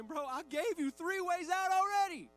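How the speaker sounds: high, loud mock-exasperated yell; exasperated outburst. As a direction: protest at someone who won't take the help given. Jesus exasperated with stuck people.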